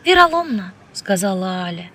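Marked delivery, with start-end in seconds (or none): 0.0-0.7 high, louder female character voice; woman's mild agreement. A narrator's reading. Woman to the man she kissed, uncomplaining. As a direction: toss it out high, without reproach. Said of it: "не предъявляя каких-либо претензий".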